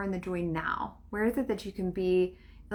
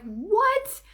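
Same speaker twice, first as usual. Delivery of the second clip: surprised what; 13 semitones higher, 4 dB louder than usual. Retold or imitated. Surprised at unknown town.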